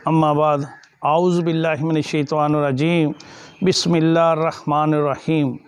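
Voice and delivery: low, measured recitation on a level tone; Arabic opening invocation. Real, not acted. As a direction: intone the sermon's opening prayer, low and even. Opening Arabic invocation before the sermon.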